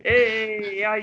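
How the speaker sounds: high, drawn-out greaser catchphrase; playful falling call; Fonz. Acted. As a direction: stretch it out like a cool sitcom greaser. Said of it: "It's more like the Fonz".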